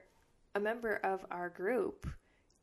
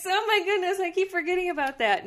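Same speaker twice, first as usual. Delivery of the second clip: high, loud sudden panic; alarmed realization; 10 semitones higher, 14 dB louder than usual. Real, not acted. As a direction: gasp it out in alarm.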